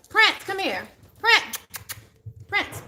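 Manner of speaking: high-pitched call to a pet; sing-song call for the puppy; calling the dog. Real, not acted. Calling her dog over.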